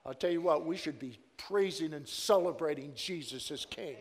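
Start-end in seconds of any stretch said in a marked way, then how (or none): none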